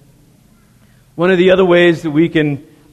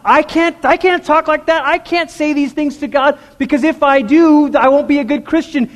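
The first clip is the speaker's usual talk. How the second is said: anxious inner voice; 9 semitones higher than usual. Retold or imitated. Imagined worried believer's inner voice.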